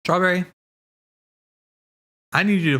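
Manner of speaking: high, eager call of a friend's name; bright shout-out to a friend; excited call. Real, not acted. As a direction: call out to get her attention. Streamer calls out to a friend.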